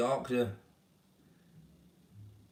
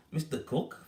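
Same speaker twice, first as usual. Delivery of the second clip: mildly puzzled repeat, a little higher; puzzled echo; 5 semitones higher than usual. Retold or imitated. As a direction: echo the name as if wondering who it is. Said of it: "Thinking, "Who's this?".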